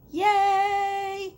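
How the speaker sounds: high, loud cheer; excited yay. Real, not acted. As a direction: squeal it out happily. Cheering about the wine.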